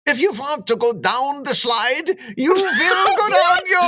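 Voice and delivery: put-on high, loudening Swedish accent gone wrong; botched Swedish accent. Lifeguard telling riders about the slide in a botched Swedish accent. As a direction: have another go at Swedish, pitched high and overdone. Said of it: "let me take another tilt at it".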